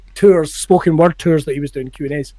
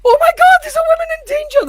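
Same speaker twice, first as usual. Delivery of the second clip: very high, loud alarm cry; hammed-up shriek, rushing at the end; mock panic; 3 dB louder, faster than usual. Imagining the instructor alarming the whole room, mock panic.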